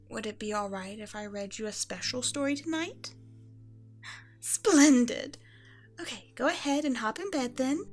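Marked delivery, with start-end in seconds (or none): 4.4-5.4 loud, high regal exclamation of delight; pleased empress, suddenly louder and higher. Acted. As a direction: exclaim it with grand approval. Delighted the child wants a story.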